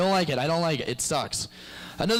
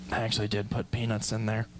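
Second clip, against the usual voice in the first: low, quiet evil confession; sinister reveal; 5 semitones lower, 4 dB quieter than usual. Acted. Evil prankster admitting the peanut trick, low. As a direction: drop low and soft, gloating.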